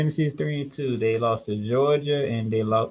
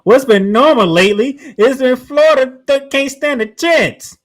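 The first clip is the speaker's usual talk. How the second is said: heated and emphatic; 10 semitones higher, 9 dB louder than usual. Real, not acted. Heated debate about Florida.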